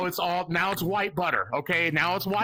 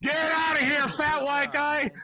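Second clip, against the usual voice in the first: mock heckler; 7 semitones higher than usual. Comic booing himself off, high jeering heckle. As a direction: jeer at himself like a crowd member.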